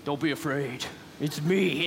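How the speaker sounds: very deep, manly voice; deep movie-narrator reassurance; Morgan Freeman. Acted. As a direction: drop low and soft, all calm authority. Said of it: "this incredibly deep, manly, like, Morgan Freeman voice".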